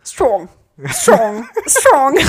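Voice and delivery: aping a friend's catchphrase; mimicking Tom. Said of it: "als ihn nachzuäffen".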